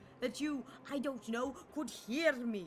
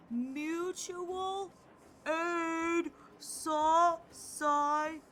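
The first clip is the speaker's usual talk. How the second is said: slow shout; 3 semitones higher, much slower than usual. Pretend. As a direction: stretch every syllable so she finally hears. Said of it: "I had to shout".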